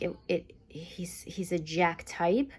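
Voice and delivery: slow, hushed whisper; whispered aside. Real, not acted. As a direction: lean in and whisper it. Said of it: "whispered aside".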